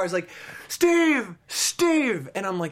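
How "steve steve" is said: high, drawn-out call of a name; mock holler, voice pitched up; yelling across a bar. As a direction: imitate a man hollering for his pal. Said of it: "he's yelling across the bar".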